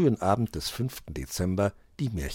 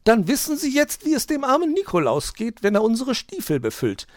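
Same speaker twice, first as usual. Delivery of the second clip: very high, loud preachy magpie; lecturing magpie; 8 dB louder than usual. A narrator's reading. Frau Elster lectures the fox about Nikolaus, high. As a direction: scold him with a moral. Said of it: "entgegnete die Elster".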